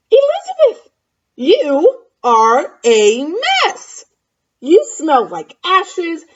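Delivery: high, loud, slow and scornful; snooty prince. Acted. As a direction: look down your nose at her. Snooty prince scolds Elizabeth.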